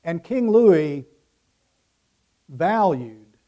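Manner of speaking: ordinary talk, nothing marked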